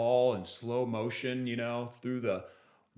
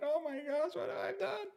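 much higher, softer dismayed cry; imitating his own horror as it sinks in; retold dismay; 5 dB quieter than usual. Realizing he wrecked his mom's new car, in a high dismayed cry. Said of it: "I'm like".

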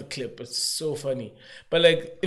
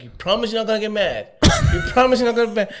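put-on suspicious boyfriend; 9 semitones higher, 5 dB louder, faster than usual. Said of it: "And God's like".

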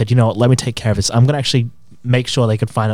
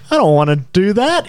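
high, whiny, pitched-up refusal; reluctant whine; 8 semitones higher than usual. Retold or imitated. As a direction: groan it like a kid dodging a chore. Dreading calling the angry man.

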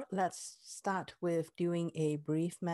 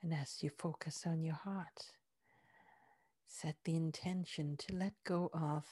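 guided meditation; 2 semitones lower, 3 dB quieter than usual. Acted. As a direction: ease them inward with soft breathy words. Heart-focus guided meditation.

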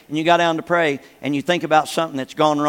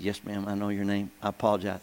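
much lower and much softer, contrite; humble apology; 10 semitones lower, 12 dB quieter than usual. Retold or imitated. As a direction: shrink down, meek and sorry. Apologizing to offended lady.

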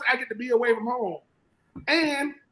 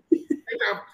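laughing mockery. Real, not acted.

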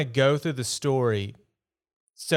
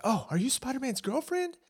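mock gushing fan; 13 semitones higher, 4 dB quieter than usual. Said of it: "Everybody's like".

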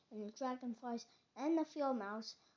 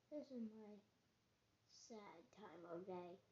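soft, low, downcast voice; sad, dropping lower and softer; glum; 4 semitones lower, 11 dB quieter than usual. Acted. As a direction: sink quietly into gloom. Toad explains his sad time.

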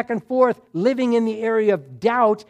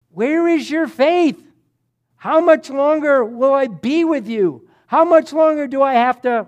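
acted exasperation, voice raised; exasperated rebuke; 5 semitones higher, 5 dB louder than usual. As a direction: scold like a master out of patience. Jesus rebuking disciples in retold scene.

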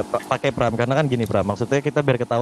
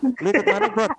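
surprised. Acted. Teasing challenge.

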